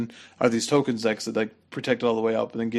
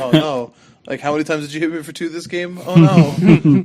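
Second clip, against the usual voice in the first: mock despair; 5 semitones higher, 9 dB louder than usual. Mocking a helpless opponent.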